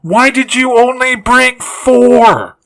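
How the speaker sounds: high, slow incredulous demand; exasperated. Acted. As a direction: drag the question out in disbelief. Exasperated questioning of plot holes.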